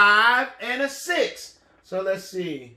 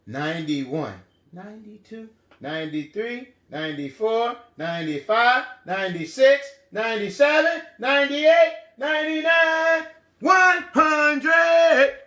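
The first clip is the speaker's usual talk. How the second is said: steady crescendo up to one hundred; low building to loud. Shown as a demonstration. Teacher building children to the end, low then loud. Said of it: "Start really low and then get loud".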